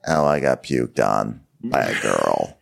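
low, slow put-upon Linda; Linda's low voice. Acted. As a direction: play Linda, glum about the purse. Voicing Linda in office skit.

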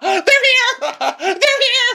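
very high, loud squeal; mock excited shriek. Rinna announcing the guests are here, hammed-up shriek. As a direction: shriek it at the top of your range, hammed-up.

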